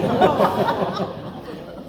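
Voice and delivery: loud burst of laughter; hearty laugh. Real, not acted. Preacher laughs with the congregation.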